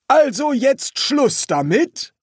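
high, loud, drawn-out demand; pressing sergeant. Acted. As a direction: push for an end to the matter. Feldwebel drängt den Leutnant. (lauter, drängender Feldwebel)

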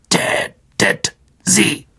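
high, slow, clicking spider order; Aragog, irritated. A narrator's reading. The old spider ordering a kill, irritated clicking voice. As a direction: click out each syllable with irritation. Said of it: "klickte Aragog gereizt".

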